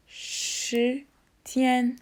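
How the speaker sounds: usual speaking voice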